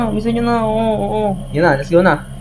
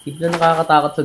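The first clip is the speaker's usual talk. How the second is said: normal talking voice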